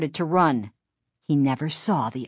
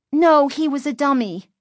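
put-on higher-pitched young girl explaining; girl's voice; 9 semitones higher, 3 dB louder than usual. A narrator's reading. Chris setting the panicked driver straight, high girl's voice. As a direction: correct him in a high girl's voice.

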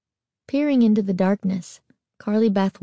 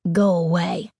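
raspy, menacing order; rasped. A narrator's reading. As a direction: rasp it out as a warning. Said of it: "she rasped".

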